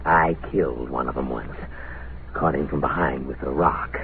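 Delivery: low, hushed confession; sinister confiding. Acted. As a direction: confide a killing with quiet relish. Madman confides a killing.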